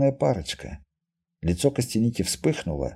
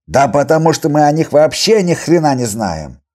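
loud, raised-pitch protest; exasperated outburst; 4 semitones higher, 9 dB louder than usual. Acted. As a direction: argue it hotly and forcefully. Healer's heated argument. (громкая раздражённая вспышка)

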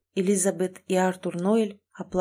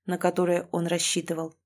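usual speaking voice

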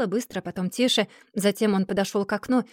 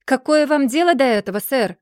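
loud, higher indignant retort; indignant exclamation; 4 semitones higher, 6 dB louder than usual. A narrator's reading. Mr. Dorrit rebuffs John's question about Amy, exclaiming indignantly. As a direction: snap back in loud offence. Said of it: "воскликнул мистер Дорит".

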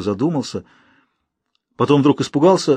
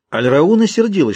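usual speaking voice

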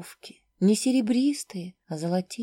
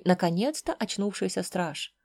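ordinary delivery

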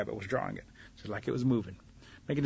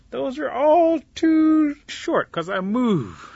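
"all too short" stretched slow, high and loud; drawn-out boast; 14 semitones higher, 9 dB louder, much slower than usual. Acted. Snake corrects the silly rabbit, "all too short" drawn out.

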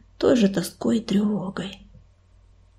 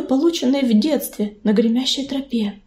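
ordinary delivery, nothing marked

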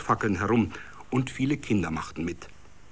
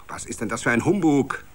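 louder, fast rising bark; angry shout; 4 semitones higher, 4 dB louder, faster than usual. A narrator's reading. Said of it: "schrie ein finsterer S-A-Mann".

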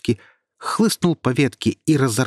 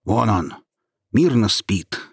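displeased. Acted. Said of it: "недовольно сказал голос".

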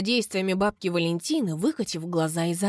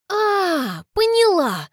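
sudden realization; 11 semitones higher, 6 dB louder, much slower than usual. Acted. Narrator suddenly understands.

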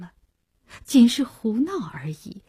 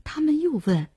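ordinary delivery, nothing marked

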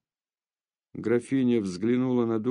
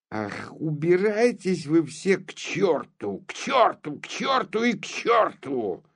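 angry shout; 7 semitones higher than usual. A narrator's reading. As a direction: bellow it out, an old man at the end of his rope. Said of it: "закричал старый граф".

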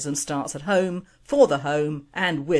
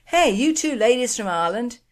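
cheery pub question; 7 semitones higher, 3 dB louder than usual. Retold or imitated. Asking women at pub.

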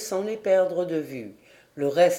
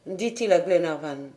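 ordinary talk

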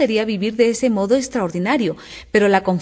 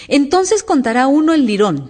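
raised, louder storybook cry; shouting; 6 semitones higher, 5 dB louder than usual. A narrator's reading. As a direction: call it out high and loud. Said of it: "chillaron los otros dos".

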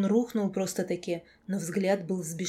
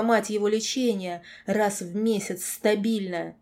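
loud, high furious ranting; unstoppable raging tirade; 3 semitones higher, 8 dB louder than usual. A narrator's reading. Girl carried away, cursing the lord, raging tirade. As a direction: let the abuse pour out at full volume. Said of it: "меня понесло окончательно".

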